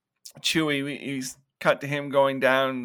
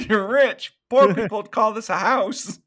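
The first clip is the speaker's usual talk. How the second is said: high, loud retold astonishment; amazed; 8 semitones higher, 6 dB louder than usual. [name] marvels at the size of Trent's tool shed, amazed. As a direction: imitate someone marveling at such wealth.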